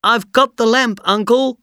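high, loud call up from the cave; shouting boy. Acted. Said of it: "he shouted".

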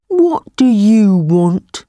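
big, slow, heavy beast voice; slow buffalo voice. Acted. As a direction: speak like a huge calm buffalo. Buffalo answers the tiger.

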